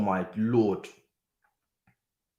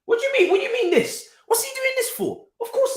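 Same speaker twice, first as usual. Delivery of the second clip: much higher, laughing disbelief; incredulous squeal; 3 dB louder than usual. Real, not acted. Outraged at a foul.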